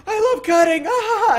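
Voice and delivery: very high, loud taunting cry; gleeful mock shout. Asura jumps the line with his buddy, gleeful imitated shout. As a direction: crow like a cheeky queue jumper.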